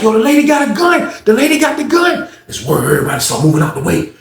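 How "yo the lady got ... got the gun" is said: much higher, urgent warning call; alarmed shout. Retold or imitated. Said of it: "it was like".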